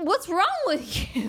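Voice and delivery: high-pitched, exasperated outburst breaking into a laugh; retold exasperation. Woman recounting a pushy neighbour's advances, exasperated. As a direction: throw it up high, half laughing in disbelief.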